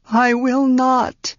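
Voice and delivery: put-on an anguished girl refusing; in agony. A narrator's reading. Tenar refuses Ged's plan, slow and anguished. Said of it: "Tanar said in agony".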